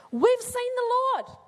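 excited announcement. Acted. Disciples announcing to Thomas.

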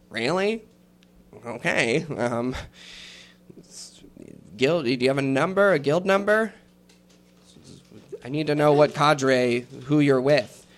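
a hint of a brisk little desk clerk; gnome clerk. Gnome clerk asking the nervous rogue for his guild number, lightly voiced.

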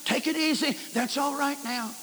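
calm a crying driver gently; soothing cop. Retold or imitated. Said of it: "He said".

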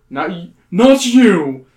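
very slow, loud and firm. Acted. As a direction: stop him firmly and slowly. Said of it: "the man says firmly".

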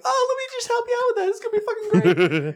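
high, fast, gushing helper; overeager mock helper. IT tech offering help, overeager. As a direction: gush with help.

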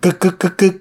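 high-pitched, staccato clicking noise; imitated key rattle. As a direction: mimic a key jamming in a lock. Imitates key jamming in lock.

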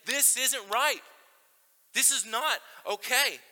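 firm rebuke. Acted. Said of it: "The Holy Spirit should be punching you in the soul and saying".

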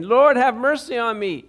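crying plea. Acted. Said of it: "A man's voice cried".

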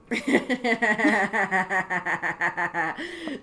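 full loud laugh. Real, not acted. Cohost laughing about Christmas with her ex, loud laugh. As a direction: burst out laughing. Laughing after interesting remark.

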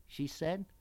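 ordinary delivery

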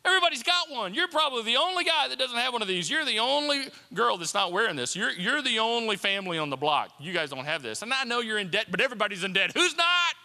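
goading high-energy salesman-like urging; pushy voice of temptation, rising to a loud finish; tempting inner voice. Acted. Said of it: "Voices that say".

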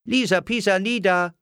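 put-on high-pitched little girl chanting a rhyme; rhyming child. A narrator's reading. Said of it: "reimte Lilli". (kindlich hoch gereimt)

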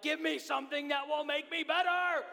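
half-playful yelled plea to be made better; retold scream. Sick pastor retelling wanting to scream at his doctor, mock desperate shout. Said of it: "I wanted to start screaming".

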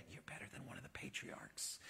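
hushed, breathy secret aside; unvoiced stage whisper. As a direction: lean in and whisper it like a confidence. Jesus quoted as praising Nathanael.

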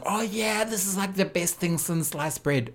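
high, soft mock enthusiasm. Judge busy praising a bad act, high mock-enthusiastic imitation. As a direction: pitch it up, lightly, as feigned delight. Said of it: "[name] was, like".

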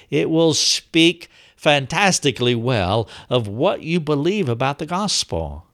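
ordinary talk

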